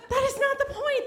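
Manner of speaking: very high, strained protest; mock outraged yelp; exasperated shriek. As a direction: cut him off in disbelief. Furious fight retold.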